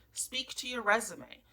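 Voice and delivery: ordinary talk, nothing marked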